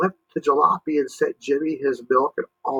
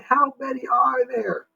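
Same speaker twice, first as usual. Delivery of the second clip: Jimmy's voice; 12 semitones higher than usual. Acted. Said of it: "Jimmy sighed".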